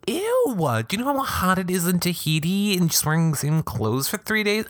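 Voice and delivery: high, incredulous disgust. Real, not acted. Reacting to two days' clothes.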